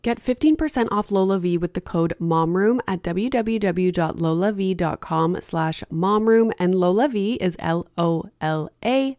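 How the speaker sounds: upbeat, loud sponsor read. Acted. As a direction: sell it like a radio ad. Host reads sponsor ad.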